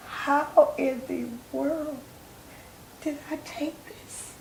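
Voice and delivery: slow, breathy near-whisper of despair; retold tearful lament. A mother alone at dawn writing, whispering through tears. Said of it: "And tears just streaming down".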